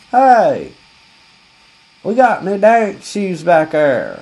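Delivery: loud, high, slow call to the back room; clerk yelling. Retold or imitated. Clerk yelling to the boss in the back room. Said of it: "or something like that".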